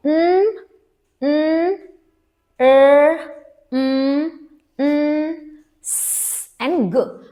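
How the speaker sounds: each letter sound held on its own; isolated phonics sounds. Shown as a demonstration. Teacher reviews the covered sounds for parents, isolated phonics sounds. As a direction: sound out न, र, म, स, ग one by one. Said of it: "हमने ये सारे sounds cover किए हैं".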